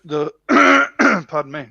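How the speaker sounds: ordinary talk, nothing marked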